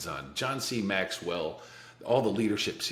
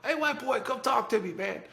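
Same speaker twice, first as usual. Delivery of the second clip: calling across the hall. Retold or imitated. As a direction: call out to a neighbor through the cell door, pitched high. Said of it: "starts calling over to me every day".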